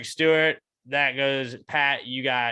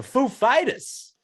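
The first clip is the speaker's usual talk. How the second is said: hammed-up Walken stage intro, louder at the end; Christopher Walken announcer voice; 10 semitones higher, 5 dB louder than usual. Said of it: "sounds like a Christopher Walken word".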